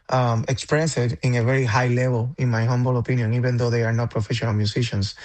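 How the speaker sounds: ordinary delivery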